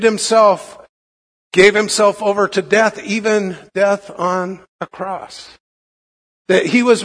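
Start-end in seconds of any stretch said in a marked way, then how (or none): none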